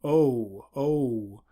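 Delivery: O gliding into oo, the English way; English diphthong O. Shown as a demonstration. Teacher demonstrating the English habit, O gliding into oo. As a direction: say O sliding into oo. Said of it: "we say O and then follow that with an oo".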